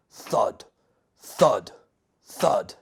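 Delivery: ordinary delivery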